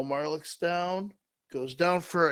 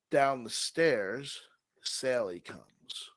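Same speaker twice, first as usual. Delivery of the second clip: usual speaking voice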